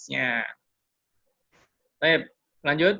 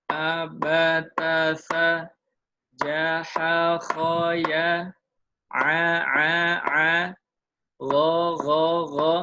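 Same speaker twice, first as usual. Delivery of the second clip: letters called out louder on one even beat; steady tempo recitation; 5 dB louder, much slower than usual. Shown as a demonstration. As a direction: keep a metronome-steady beat. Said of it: "Dan dalam tempo yang teratur". (dibaca keras dengan tempo teratur)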